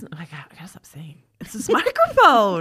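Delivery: unvoiced whisper into the mic. Real, not acted. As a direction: breathe it into the microphone. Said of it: "trying to be [name]".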